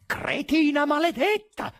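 high, loud, drawn-out curse; furious, choking yell; rasping rage. A narrator's reading. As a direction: hurl the insult in a strangled scream.

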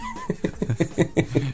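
high, soft giggling; amused laugh. Real, not acted. Laughing at the co-host's odd word, amused.

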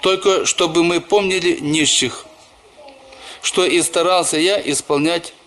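usual speaking voice

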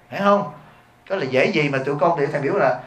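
usual speaking voice

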